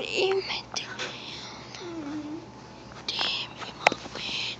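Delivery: breathy hidden whisper, slow. Acted. Player hiding from big brother, whispering. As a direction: hush it so nobody hears.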